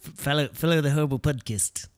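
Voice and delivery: mock New Zealand accent; Kiwi accent. Co-host retrying the plug, Kiwi accent. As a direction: squeeze the vowels like a New Zealander. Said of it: "That's Kiwi".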